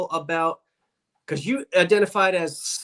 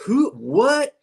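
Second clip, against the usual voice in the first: puzzled college classmates; much slower than usual. Retold or imitated. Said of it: "and they, everybody".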